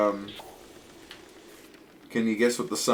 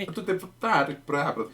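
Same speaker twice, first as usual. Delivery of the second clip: Charles Martinet fake Italian; 6 semitones higher, 4 dB quieter than usual. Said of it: "I can't do Charles Martinet's fake Italian".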